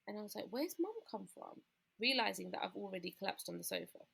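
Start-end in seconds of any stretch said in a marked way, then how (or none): 0.5-1.6 soft, high, slow bewildered question; puzzled. Retold or imitated. Collapsed woman hearing her mum's voice, acted soft puzzlement. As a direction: retell a dazed wondering, quietly and pitched up. Retold confusion while collapsed on sofa.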